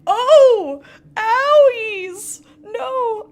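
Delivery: high, loud cries of mock heartbreak; hurt cries. Real, not acted. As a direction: yelp like she's been stabbed in the heart. Stung by love interest's betrayal.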